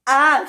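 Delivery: short, high acted scream; quick high-pitched cry of alarm. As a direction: yelp "ah" high up. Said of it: "she screamed".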